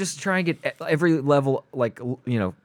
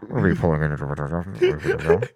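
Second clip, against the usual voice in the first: pilot announcement; 9 semitones lower, slower than usual. Pretend.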